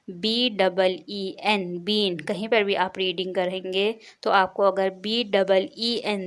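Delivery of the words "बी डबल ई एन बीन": बी डबल ई एन spelled very slowly; slow spelling. Shown as a demonstration. Teacher showing how बीन is written, slow. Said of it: "कैसे होता है ये?".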